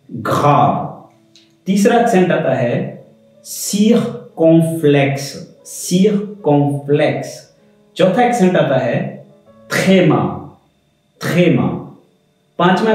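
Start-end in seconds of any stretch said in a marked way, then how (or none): none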